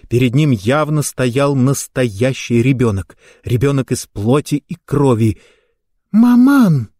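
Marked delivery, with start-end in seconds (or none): 6.1-6.9 put-on very high, loud little-child call; high-pitched childlike cry; tender child's voice. A narrator's reading. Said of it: "произнёс нежный детский голосок".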